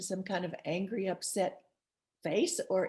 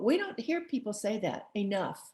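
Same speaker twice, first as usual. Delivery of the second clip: ordinary talk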